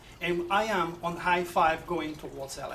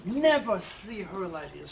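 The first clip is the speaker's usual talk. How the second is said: normal talking voice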